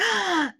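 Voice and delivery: high, delighted mock gasp; sharp high intake of breath, play-acted; excited gasp. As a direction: gasp in exaggerated thrilled surprise. Acts out over-excited reaction to compliment.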